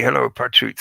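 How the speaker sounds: ordinary talk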